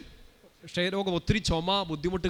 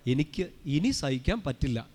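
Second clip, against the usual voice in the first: slow, spaced words, each given room; saint's measured tone; 2 semitones lower, slower than usual. Shown as a demonstration. Preacher showing how the saint said it, slow and deliberate. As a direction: take it slowly, pausing after the first word. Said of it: "വായിച്ചത് ഇങ്ങനെയാണ്".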